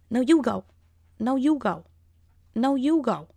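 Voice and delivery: sing-song rise and fall, imitating her; imitated insistence. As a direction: act out her stubbornly waving him on. Acting out her insistent standoff.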